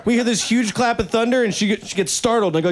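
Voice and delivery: ordinary talk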